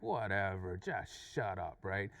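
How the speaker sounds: low, slow brush-off; dismissive. Retold or imitated. Other driver answers the challenge with a look, dismissive. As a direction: drop low and dismiss him slowly. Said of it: "his gestures is just looking at me like".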